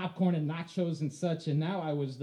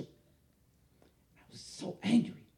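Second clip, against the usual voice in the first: usual speaking voice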